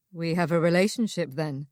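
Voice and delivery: teasing, a smile in the voice; smiling voice. A narrator's reading. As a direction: let the grin come through. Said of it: "She could hear the smile in his voice".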